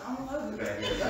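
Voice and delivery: laughing through a fake denial. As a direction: chuckle as you disown him. Pretending not to know brother.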